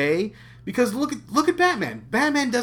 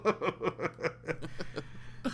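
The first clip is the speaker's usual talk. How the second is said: breathy laugh; 8 dB quieter than usual. Real, not acted. Laughing at the Doomsday mention.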